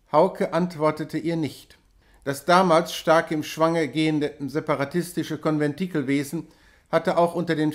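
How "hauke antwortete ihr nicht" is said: ordinary delivery, nothing marked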